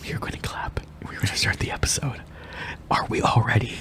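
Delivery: soft unvoiced whisper. Real, not acted. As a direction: whisper the setup. Whispers to set up episode.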